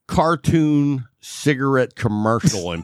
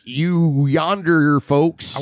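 slow, mock Southern folksy drawl; much slower than usual. Santa fitting in down South, slow mock Southern drawl. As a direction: lightly drawl it like a Southern local. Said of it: "he'll be like".

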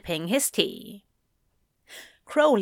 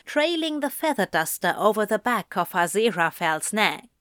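ordinary delivery, nothing marked

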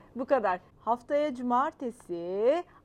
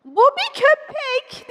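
very high, loud, drawn-out cry; acted shout; 15 dB louder, much slower than usual. Front-row spectator standing up at the dog, high shout. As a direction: shout it in shrieking disbelief. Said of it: "diye bağırdı".

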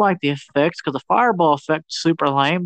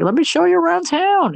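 high excited quote; 12 semitones higher than usual. Retold or imitated. Kids voiced as tour guide.

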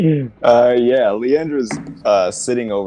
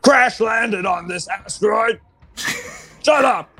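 high, slow, shouted emperor rant; booming demon lord; 12 semitones higher, slower than usual. Acted. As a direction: bellow it back, grandly. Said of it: "he goes, he's like".

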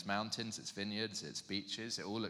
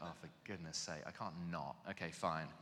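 low, soft, reluctant giving in; grudging surrender; 5 semitones lower, 8 dB quieter than usual. Retold or imitated. Reluctantly giving in to a friend's request to pray, grudging. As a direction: grumble your reluctant agreement under your breath.

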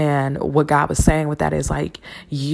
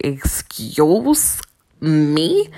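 incredulous excuse me; much slower than usual. Retold or imitated. Taken aback by God's reading.

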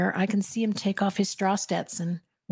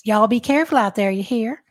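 put-on Southern accent; 3 semitones higher, 5 dB louder than usual. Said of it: "that sounds just like her".

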